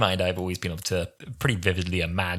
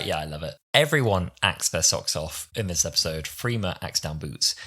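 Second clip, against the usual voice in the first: normal talking voice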